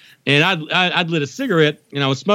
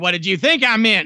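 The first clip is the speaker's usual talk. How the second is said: imitating mom; 6 semitones higher than usual. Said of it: "she hates it when I do her".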